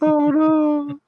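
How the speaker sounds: high mock wail. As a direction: wail it in fake despair.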